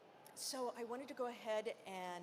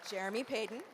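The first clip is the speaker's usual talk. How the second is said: loud, raised-pitch introductions; announcer roll call; 3 semitones higher, 3 dB louder than usual. Acted. Host introducing poets to the festival crowd, announcer roll call. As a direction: call each name out to a crowd.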